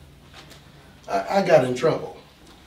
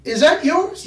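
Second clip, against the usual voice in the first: hammed-up nervous cop; startled cop; 11 semitones higher, 4 dB louder than usual. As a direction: cop spotting the gun. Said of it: "cop alarmed at gun".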